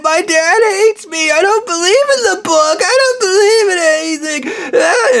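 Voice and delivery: high, slow blubbering whine; crying Stu impression. Mocking Stu on the phone, high slow crying whine. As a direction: sob out your despair like a broken man. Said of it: "he was".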